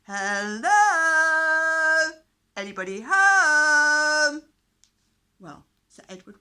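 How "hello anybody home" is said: calling out loud across a huge hall, hands cupped; shouting. A narrator's reading. Knight calls out to an empty castle hall, loud shout. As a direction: bellow it to the far end of the hall. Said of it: "he shouted".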